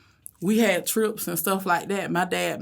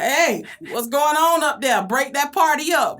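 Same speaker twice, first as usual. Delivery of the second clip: higher, louder teasing Southern call to the back of the bus; friendly Southern accent; 7 semitones higher, 5 dB louder than usual. Retold or imitated. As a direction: sing it out, Southern and playful. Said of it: "I have that little, you know, that Southern accent".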